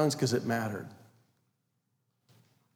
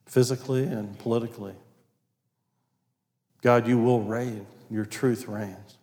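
low, soft, measured prayer; closing prayer; 5 semitones lower, 5 dB quieter than usual. Real, not acted. Preacher thanking God for the congregation, quiet prayer. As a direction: pray quietly and earnestly, with pauses.

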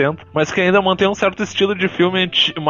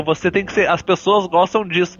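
ordinary talk

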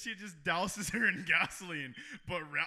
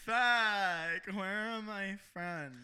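put-on slow, drawn-out drunken whine; slurry mock-drunk lament; drunk bar-goer; much slower than usual. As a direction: stretch it out like a lost drunk. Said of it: "it is like".

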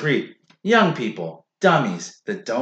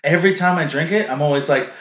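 ordinary talk, nothing marked

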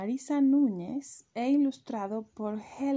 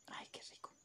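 secret whispered "Ay, qué rico"; 21 dB quieter, faster than usual. Acted. Chick sneaking cake, whispering "Ay, qué rico".

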